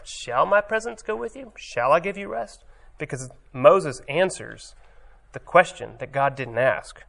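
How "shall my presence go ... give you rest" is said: much higher, doubting questioning tune. Shown as a demonstration. Moses hears God's promise as a judgment, higher doubting question. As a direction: turn the promise into a challenge. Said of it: "Moses heard it as a question, as a judgment".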